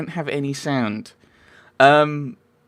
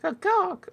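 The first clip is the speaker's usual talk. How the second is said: high squawky bird noises; mock bird cries; bird call. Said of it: "they were pigeons".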